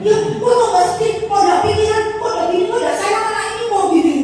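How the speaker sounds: high, loud volley of reproaches; hammed-up retold marital scolding; worked-up wife. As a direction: fire off exasperated questions at a husband. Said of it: "Saya kan jadi emosi".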